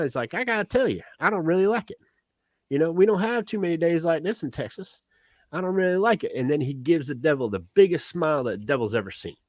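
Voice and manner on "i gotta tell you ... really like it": lightly voiced Texan. Tex admitting discomfort to the devil, lightly voiced Texan. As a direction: lightly play a Texan owning up.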